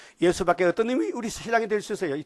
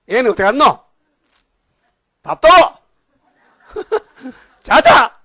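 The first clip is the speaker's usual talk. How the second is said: loud, higher mock husband demands; gruff Gyeongsang husband; 8 semitones higher, 14 dB louder than usual. Preacher mocking a Gyeongsang husband barking at his wife. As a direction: ham up a curt husband home from work. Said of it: "남자가 또 도둑놈 같아".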